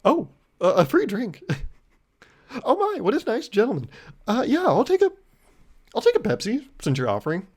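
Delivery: faked surprise. Pretending to be surprised by the free Pepsi, hammed-up. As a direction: gush like you never expected a gift. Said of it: "I try to act surprised".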